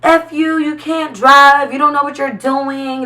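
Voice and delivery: lightly voiced angry man, high and mocking; a hint of a mock road rage rant; angry driver cursing. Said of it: "he was like".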